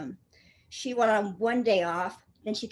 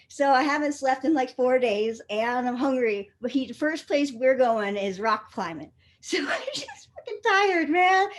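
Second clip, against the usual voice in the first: ordinary talk, nothing marked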